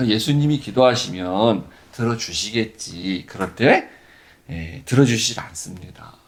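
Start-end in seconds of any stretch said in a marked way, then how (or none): 0.1-3.2 soft, slow musing to oneself; quiet inner assumption. Shown as a demonstration. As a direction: think it to yourself, softly. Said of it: "우리 이렇게 생각해야".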